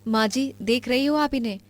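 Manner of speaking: usual speaking voice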